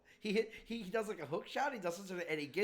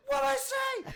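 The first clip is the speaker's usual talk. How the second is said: innocent outrage; 7 dB louder than usual. Retold or imitated. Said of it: "That's it, you're".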